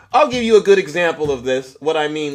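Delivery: usual speaking voice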